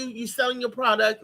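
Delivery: normal talking voice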